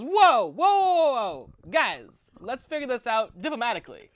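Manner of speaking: high, loud French-tinged Walken voice that settles down; French Christopher Walken. Acted. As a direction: burst in high and loud, then smooth it over like Walken with a French lilt. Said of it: "French Christopher Walken".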